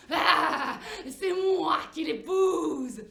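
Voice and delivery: very slow, loud wolf bellow on moi; terrible wolf voice. Acted. Wolf booming from the dark forest, slow roar. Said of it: "une terrible voix dans le noir".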